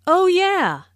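Oh yeah jumps high, sudden recall; sudden remembering. Shown as a demonstration. Teacher replays a customer suddenly remembering. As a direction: blurt it out as it comes back to you. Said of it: "I just remembered something".

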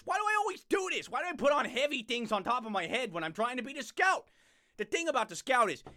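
usual speaking voice

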